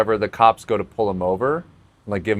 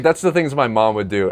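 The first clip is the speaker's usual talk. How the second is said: ordinary delivery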